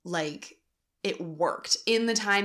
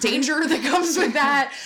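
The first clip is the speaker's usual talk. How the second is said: high, loud laughing finish; cracking up while agreeing on danger; 9 semitones higher, 10 dB louder than usual. Real, not acted. Laughing with partner's interjection.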